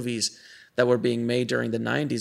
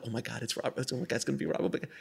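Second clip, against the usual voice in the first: excited hush; 10 dB quieter, faster than usual. Retold or imitated. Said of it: "and I'm like".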